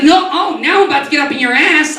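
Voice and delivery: high mock-threatening girl; a hint of tough street sass; sassy city girl. Comic imitates the city girl threatening him, high mock sass. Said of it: "She's like".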